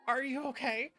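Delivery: ordinary talk, nothing marked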